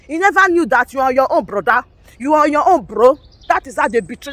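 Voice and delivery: ordinary delivery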